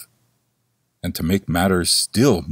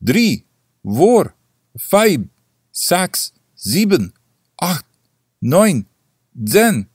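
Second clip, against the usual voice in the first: high, louder Dutch-tinged count; little repeaters answering; 7 semitones higher, 4 dB louder, slower than usual. A narrator's reading. Little watches answer the bell, high and loud. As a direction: chirp the numbers back like tiny watches. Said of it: "answered the others".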